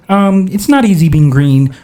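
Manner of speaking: puppet frog impression; put-on froggy character voice; Kermit the Frog. Shown as a demonstration. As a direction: try out a cartoon frog voice. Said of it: "I can sing like Kermit the Frog".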